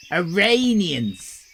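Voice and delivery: "Iranians" said slowly as "i-RAY-nians"; slow careful Iranians. Shown as a demonstration. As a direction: spell "Iranians" out slowly for the crowd. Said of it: "People from Iran are called".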